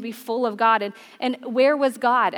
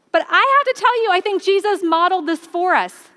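high, loud burst to grab attention; preaching emphasis; 6 semitones higher, 4 dB louder than usual. Real, not acted. Pastor rousing the congregation, high and loud. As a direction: lift it up and call them in.

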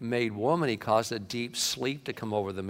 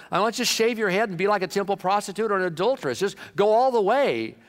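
ornery Paul; 6 semitones higher, 4 dB louder than usual. Acted. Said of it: "Paul kinda gets ornery here".

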